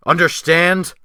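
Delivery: sharp, high, loud snap; a single barked question, loud and high; whip-crack snap. A narrator's reading. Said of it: "snapped like the lash of a whip".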